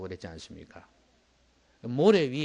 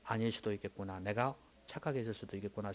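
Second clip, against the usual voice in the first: soft, humble self-questioning, softer; humbly; 5 semitones lower, 9 dB quieter than usual. Retold or imitated. Believer humbly doubting himself, soft and humble. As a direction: admit you may be wrong, gently. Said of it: "겸손하게".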